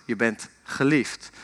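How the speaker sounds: soft, low, slow echo; returning echo. Retold or imitated. Mountains answer the father's call, soft returning echo. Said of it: "En jij hoort terug".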